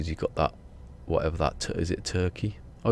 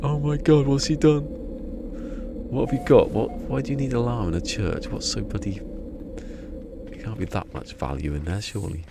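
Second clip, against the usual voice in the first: panicked surprise; 6 semitones higher than usual. Retold or imitated. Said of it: "We thought".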